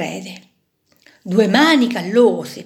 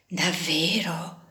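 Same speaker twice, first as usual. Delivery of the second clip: hushed; 5 semitones lower, 8 dB quieter, slower than usual. Acted. Screw hopeful, disbelieving.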